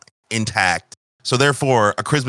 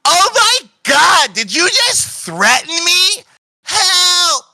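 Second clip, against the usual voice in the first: very high, loud, panicked screams; screaming woman; 5 dB louder, much slower than usual. Acted. Woman screaming for help on the train, high and panicked. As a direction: shriek for help like she's in danger. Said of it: "She starts screaming on this fucking train".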